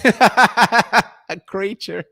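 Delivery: laughing through the words. Real, not acted. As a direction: crack up mid-phrase. Laughing at the dragon filter.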